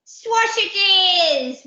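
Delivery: put-on high excited toddler whoop. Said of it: "whooped little treasure".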